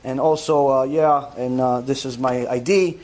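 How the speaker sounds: low, even transactional voice with hesitant filler; demonstrating polite, impersonal small talk in a low monotone; cordial but detached. Shown as a demonstration. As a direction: talk with eyes down, low and unengaged. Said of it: "and that's how you talk".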